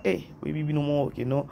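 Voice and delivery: ordinary delivery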